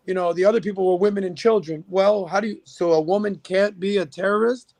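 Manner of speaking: usual speaking voice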